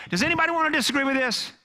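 preacher voicing Peter's challenge, high and loud; challenging call. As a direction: Peter daring anyone to object, loud and high.

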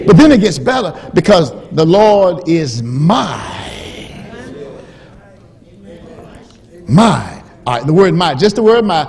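loud, high, rising sermon delivery; full-force preaching. Acted. Preacher driving home the word my to the church, loud. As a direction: build the point to the rafters.

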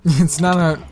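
loud excited call. Real, not acted. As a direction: call out the name gleefully. Excited whisper spotting friend.

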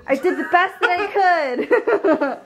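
high laughing protest. Real, not acted. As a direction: say it slowly while laughing. Defending her croissants.

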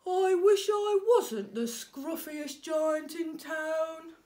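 a giant wishing mournfully; sadly. A narrator's reading. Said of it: "he said sadly".

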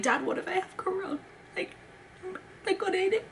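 a frightened little plea to Dad; scared whimper. Retold or imitated. Begging her dad about corona, high scared whimper. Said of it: "I'm, like, so scared".

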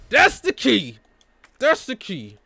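high, loud cry of discovery; excited shout. Real, not acted. Player finally spotting the key, excited shout.

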